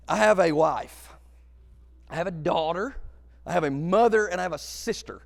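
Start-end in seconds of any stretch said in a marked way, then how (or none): none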